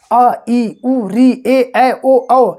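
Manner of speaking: each Hindi vowel उ, री, आ, ई, उ, ए, ऐ, ओ, औ said one by one, clear and separate; vowel sounds demo. Shown as a demonstration. Teacher demonstrating Hindi vowels to students, clearly separated vowel sounds. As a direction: spell out every vowel उ to औ slowly and cleanly for the class. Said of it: "जैसे आप बोलते हैं".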